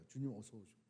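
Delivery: softly, almost breathing the words 주님, 어서 오시옵소서; soft hushed prayer. Shown as a demonstration. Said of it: "그 찬양의 그 내용이 뭐예요?".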